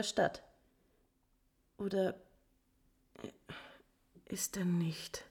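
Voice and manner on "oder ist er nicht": slow, shaken whisper; whispering in disbelief; stunned whisper. Acted. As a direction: barely voice it, as if in shock. Countess stunned by news of death.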